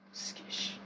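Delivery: voiceless whispered swear; whispered curse. Real, not acted. Gamer whispers a swear at the game. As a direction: hiss the curse under breath.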